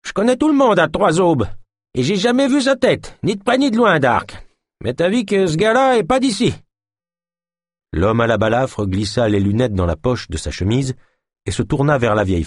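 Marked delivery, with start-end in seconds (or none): 0.1-6.5 put-on high, quavering old woman's voice; an old crone butting in. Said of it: "d'une voix chevrotante". (voix de vieille femme chevrotante, aiguë)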